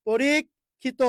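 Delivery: পরীক্ষিত as "poreek... khito"; careful two-part teaching split of পরীক্ষিত, ending on O; split-word demonstration. Shown as a demonstration. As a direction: hold "reek" in পরীক্ষিত, pause, then "khito". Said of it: "ওকার হয়ে গেল".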